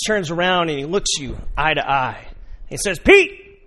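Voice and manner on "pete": loud high call across the boat; Jesus calling loudly. Acted. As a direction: call him out further. Jesus calls to Peter.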